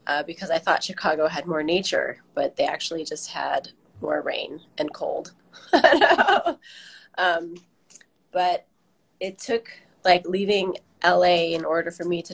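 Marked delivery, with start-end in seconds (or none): 5.7-6.5 short, high-pitched real laugh; quick burst of real laughter. Real, not acted. Guest joking about moving for rain and cold, laughing. Laughs at own Chicago remark.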